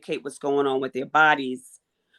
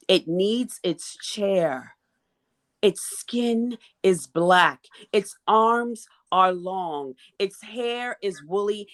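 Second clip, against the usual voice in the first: spoken word poem; 3 semitones higher than usual. Acted. Poet reciting her piece to a panel, rhythmic and emphatic. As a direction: perform it like a slam poem.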